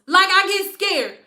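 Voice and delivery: usual speaking voice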